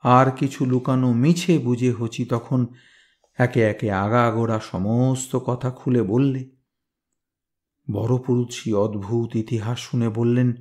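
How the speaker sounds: plain reading voice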